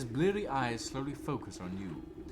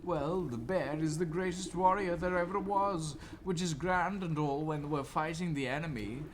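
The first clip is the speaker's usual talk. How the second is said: higher, put-upon officer; weary officer voice; 9 semitones higher than usual. Acted. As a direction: complain like a tired officer. Officer explains the bear.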